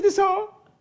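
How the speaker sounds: high, squeaked disbelief; incredulous scoff. Acted. Champion looks down at puny Cat, incredulous. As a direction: scoff in a high-pitched question.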